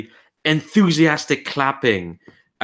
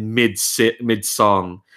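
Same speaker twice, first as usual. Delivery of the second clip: ordinary talk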